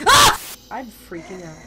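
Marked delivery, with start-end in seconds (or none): 0.1-0.4 short, very loud high yelp; sudden loud shriek; startled scream. Real, not acted. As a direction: yelp as if jumped. Jump scare.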